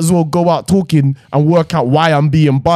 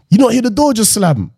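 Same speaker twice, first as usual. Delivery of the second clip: dad alarmed; 9 semitones higher than usual. Retold or imitated. Dad voiced in retold story.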